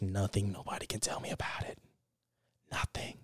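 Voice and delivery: hushed intense whisper. Real, not acted. Vowing to himself in a hushed whisper. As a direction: breathe it low and fierce.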